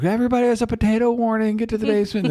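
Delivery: high-pitched mock alarm; joking announcement. Parent sending everyone to the basement, joking high-pitched alarm. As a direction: call a silly warning way up high. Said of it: "we all joked".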